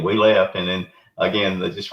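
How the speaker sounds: usual speaking voice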